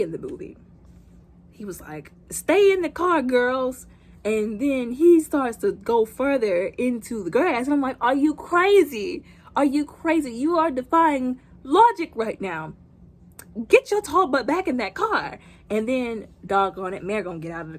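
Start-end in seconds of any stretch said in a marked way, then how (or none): 2.3-3.9 put-on high, loud call to the girls; raised-voice acted command; imitated father's order. Father calling out to daughters. 8.0-15.4 higher, drawn-out, incredulous telling-off; animated, emphatic disbelief; exasperated scolding. Acted. Scolding the film character.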